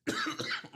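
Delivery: real choking cough on water; coughing fit. Real, not acted. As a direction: cough out water down the wrong pipe. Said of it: "Wrong pipe with the water".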